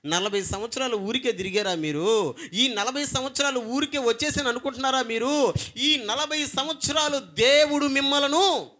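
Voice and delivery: high, loud, building rhetorical questions; preaching at full force. Acted. Moses challenging the Israelites, loud and high. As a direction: press the people with each question.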